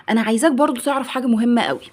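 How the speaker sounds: usual speaking voice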